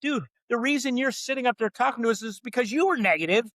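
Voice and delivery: high, incredulous dude voice; sarcastic retort. Retold or imitated. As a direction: scoff it at the boss. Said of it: "we're looking at each other going".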